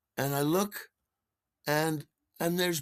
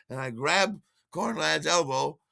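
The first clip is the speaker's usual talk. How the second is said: ordinary talk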